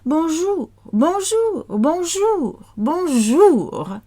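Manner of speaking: high sing-song "bonjour"; repetition drill. Shown as a demonstration. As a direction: chant "bonjour" high for learners to copy. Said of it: "so repeat it again and again".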